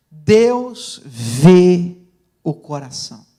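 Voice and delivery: slogan for the crowd to repeat; emphatic key phrase. Real, not acted. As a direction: punch "Deus" and "vê", let "o coração" fall away.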